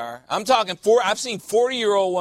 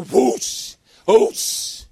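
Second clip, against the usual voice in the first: sharp, breathy whoosh, higher pitched; whoosh of flames; 6 semitones higher, 5 dB louder than usual. Shown as a demonstration. Preacher showing lighter fluid catch a match, imitated whoosh. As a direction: imitate fuel bursting into flame.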